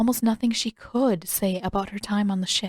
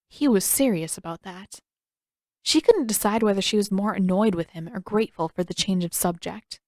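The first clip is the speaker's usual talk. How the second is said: ordinary delivery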